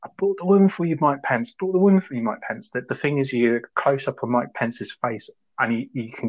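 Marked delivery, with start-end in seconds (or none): 0.0-2.7 mock shouting. Retelling Borat's stunt at the rally, mock shouting. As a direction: imitate someone calling out, voice jumping high.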